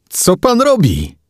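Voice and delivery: high, stretched-out question; high-pitched, frightened protest; alarmed demand. A narrator's reading. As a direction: demand an answer in panic. Passenger panics as cab speeds.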